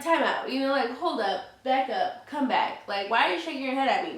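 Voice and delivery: rapid-fire indignant challenge; replaying her angry demand to the truck driver; retold angry confrontation. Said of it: "it just made me so angry".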